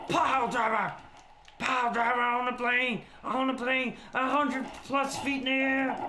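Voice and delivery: hammed-up wrestling commentator call; excited play-by-play. Streamer calls his own pile driver on the plane, mock commentary. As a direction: call the big move, voice held high.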